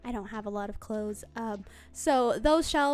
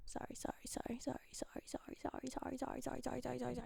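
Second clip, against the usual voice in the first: rapid hushed apologies; whispered sorry; 15 dB quieter, much faster than usual. Real, not acted. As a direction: whisper a string of quick sorries. Whispered apologies after a flubbed take.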